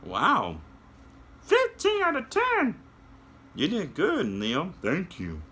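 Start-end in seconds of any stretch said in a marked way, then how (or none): 1.4-2.8 soar up into a tiny high voice; high puppet voice. Acted. Puppet shows kids how to fly, very high voice.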